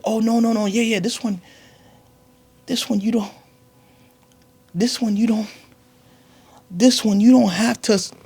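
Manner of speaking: put-on high, loud, stammering kid voice; a squeaky kid scrambling for excuses; panicking kid. Son stalling his mother about the signature, high and panicked.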